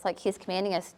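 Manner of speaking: ordinary delivery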